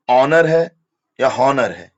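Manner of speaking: ऑनर loud with no h, then हॉनर with an h; silent h contrast, emphatic. Shown as a demonstration. Teacher loudly contrasts ऑनर and हॉनर. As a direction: stress ऑनर without h, against हॉनर with h. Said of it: "ऑनेस्ट है या हॉनेस्ट है".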